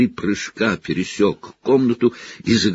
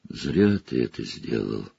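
much lower and softer; low rebuke; 9 semitones lower, 7 dB quieter than usual. Acted. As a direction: say it low and grave.

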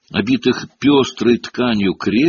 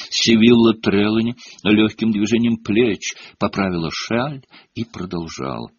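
ordinary delivery

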